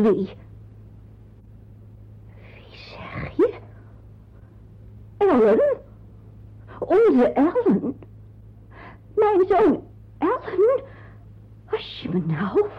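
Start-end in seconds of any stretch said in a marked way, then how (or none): 2.5-3.5 stunned whisper. Acted. Aunt hears a shocking name. 5.2-10.8 shocked disbelief. Acted. Aunt hears who the father is.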